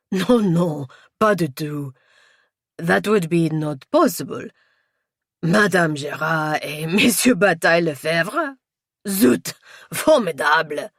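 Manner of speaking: put-on amused, incredulous French accent; loud, tickled Frenchman's French-accented disbelief; chuckling French inspector. A narrator's reading. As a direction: scoff merrily with a French accent. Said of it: "He chuckled to himself".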